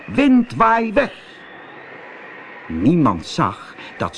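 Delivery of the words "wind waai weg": high, drawn-out calling voice; loud call. Acted. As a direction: call it out high, as a spell-breaking command. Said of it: "heel hard roepen". (hoog en langgerekt roepen)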